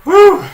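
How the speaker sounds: loud, high whoop; real celebratory whoop, very loud; excited whoop. Real, not acted. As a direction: let out a big high-pitched woo. Cheering after the long scan.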